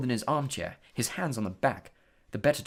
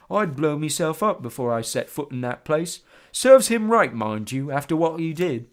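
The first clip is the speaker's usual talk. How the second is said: a hint of a gruff old driver; grumbling bus driver; 3 semitones higher, 7 dB louder than usual. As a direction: grumble it under his breath.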